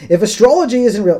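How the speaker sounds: loud Australian reading of the letter; mock Australian letter reading; Australian accent. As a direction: put on an Australian voice for the line. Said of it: "should I do it in the Australian?".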